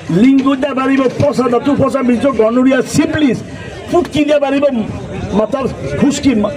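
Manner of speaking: high-pitched hawker's sales pitch. Real, not acted. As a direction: rattle off the cures like a street hawker. Street medicine seller's escalating pitch.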